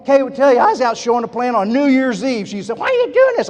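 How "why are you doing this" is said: high-pitched incredulous quote; mock questioning. Wife questions him showing the plan on New Year's Eve, mock questioning. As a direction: imitate her puzzled question, pitched way up.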